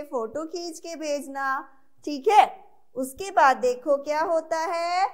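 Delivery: normal talking voice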